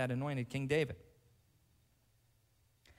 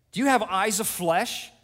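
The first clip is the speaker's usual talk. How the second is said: higher, louder challenge; Job challenging God; 7 semitones higher, 11 dB louder than usual. Acted. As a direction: demand it of God. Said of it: "Job asks God".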